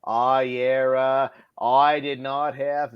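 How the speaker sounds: slow faux Boston accent; JFK. Trying a presidential denial in a faux Boston accent. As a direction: do a drawn-out Boston politician's accent. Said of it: "my faux Boston, uh, J F K".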